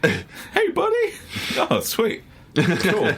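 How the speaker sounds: high-pitched comic little voice; mock casual greeting. Acting out his escape in game.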